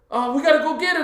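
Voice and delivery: high, loud hammed-up urging; retold urgent plea. Friend urging a trip back for the bag, urgent. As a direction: whine it loudly like a worried friend.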